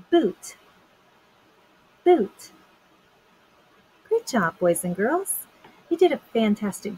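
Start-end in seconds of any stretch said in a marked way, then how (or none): 0.1-2.5 "Boot" said high and loud with a crisp, clearly released final t; Boot, final t stressed. Shown as a demonstration. Teacher to children, "Boot" said high and loud with a sharp final t. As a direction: pop the t at the end of "Boot" for the class. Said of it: "When I say boot, you say t".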